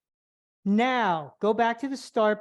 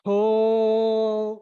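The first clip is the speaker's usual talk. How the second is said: drawn-out cue; 6 dB louder than usual. Shown as a demonstration. Instructor demonstrating the pull.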